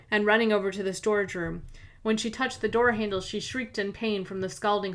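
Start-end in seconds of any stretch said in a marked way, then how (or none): none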